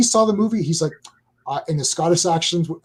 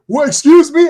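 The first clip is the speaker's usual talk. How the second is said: loud, high mock-indignant retort; offended disbelief; 8 semitones higher, 9 dB louder than usual. Acting out the pissed-off Scottish coworker, mock outrage. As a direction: imitate someone taking loud, high-pitched offence.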